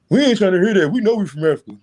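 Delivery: high, loud mock protest; mocking objectors. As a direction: imitate people refusing to listen. Mimics people rejecting his dates.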